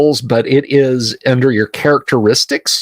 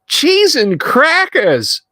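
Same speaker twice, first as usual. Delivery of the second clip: very high, squawking scolding woman; comic high-pitched neighbour's scolding; woman yelling down; slower than usual. Acted. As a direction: holler down from a window in a high, stretched-out falsetto. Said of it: "she yells down".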